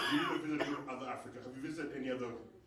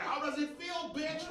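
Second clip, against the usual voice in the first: high, mocking gloat; gloating taunt; 14 semitones higher, slower than usual. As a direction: rub it in their faces. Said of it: "I was like".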